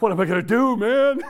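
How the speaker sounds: exasperated, high-pitched mock wail; mock panic. Trucker stuck at the scales at night, loud mock panic.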